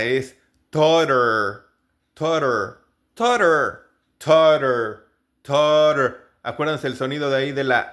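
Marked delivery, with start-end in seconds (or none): 0.7-6.2 "totter" with the middle tt as a Spanish r; flapped T. Shown as a demonstration. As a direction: say "totter" as "tor-er". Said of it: "como una R nuestra". ("totter" con la doble T como una R nuestra)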